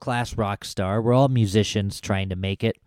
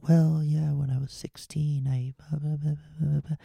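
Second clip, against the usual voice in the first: soft, mumbled musician voice, much quieter; mumbling shy musician; 4 semitones higher, 6 dB quieter than usual. Retold or imitated. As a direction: mumble it into your chest like a bashful rocker. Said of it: "they sound like".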